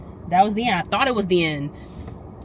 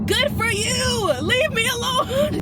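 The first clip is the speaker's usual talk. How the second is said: high, slow, whiny mock cry; drawn-out high fed-up whine; sarcastic exasperation; 3 dB louder, slower than usual. Real, not acted. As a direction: wail it like you're fed up. Venting at the man.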